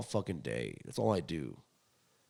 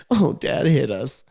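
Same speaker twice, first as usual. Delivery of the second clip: slow, whiny mock of his brother, much louder and higher; 5 semitones higher, 13 dB louder, slower than usual. Mocking his brother's complaint about dad, whiny. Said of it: "My brother's like".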